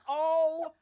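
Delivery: a high, loud excited "Oh"; held-back scream. Retold or imitated. Hearing she's going to London, loud and high. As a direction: nearly scream with joy but hold it in. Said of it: "I tried to keep from screaming".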